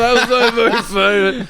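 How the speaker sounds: high, drawn-out imitation of laughing while talking; slow, high, mock-laughing squeal; suppressed laughter. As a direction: act out a kid cracking up. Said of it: "He's like, trying not to".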